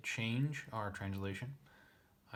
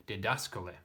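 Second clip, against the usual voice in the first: ordinary talk, nothing marked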